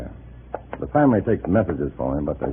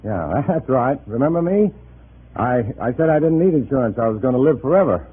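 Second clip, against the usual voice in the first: much higher, falsely breezy phone chatter; forced cheerful cover; 8 semitones higher than usual. Pretend. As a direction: sound carefree so the comrade suspects nothing. Fakes a chatty call to cover.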